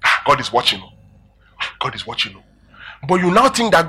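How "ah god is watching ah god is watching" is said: low, hushed mock dread; scared of God. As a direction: drop low and soft, as if being watched. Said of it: "makes us get scared of God".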